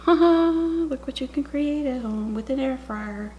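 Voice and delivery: slow, high mock-commercial pitch; proud showcase voice. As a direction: sell it like an infomercial. Proud ad-style line, slow.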